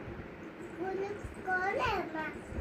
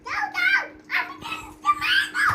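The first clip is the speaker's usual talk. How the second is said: excited shriek; 8 dB louder than usual. Real, not acted. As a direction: squeal it out in excitement. Child shrieks during play. (উত্তেজনায় খুব উঁচু গলায় চিৎকার)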